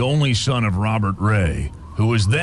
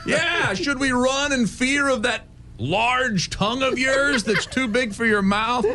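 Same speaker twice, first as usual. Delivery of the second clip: mocking taunt; 11 semitones higher than usual. Taunting the ghost.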